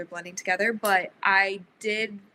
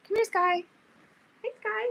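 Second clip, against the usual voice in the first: much higher, cooing call to a cat; pet voice; 13 semitones higher than usual. Real, not acted. Calling her cat over.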